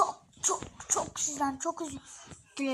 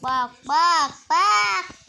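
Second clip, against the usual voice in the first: loud, higher calling; calling out loudly; 4 semitones higher, 12 dB louder, much slower than usual. Real, not acted. Gamer calling viewers to look, loud shout.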